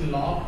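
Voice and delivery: लॉक, its CH sounded as क. Shown as a demonstration. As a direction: say लॉक with a hard K at the end. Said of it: "सीएच का pronunciation क होगा".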